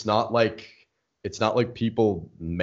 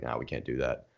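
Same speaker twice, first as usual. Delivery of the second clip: flat refusal; 10 semitones lower, 10 dB quieter than usual. Retold or imitated. Voicing instructor refusing.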